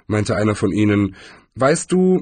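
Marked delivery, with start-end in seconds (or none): 1.6-2.2 higher, patronizing wizard voice; lofty old wizard. A narrator's reading. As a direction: play a smug senior wizard.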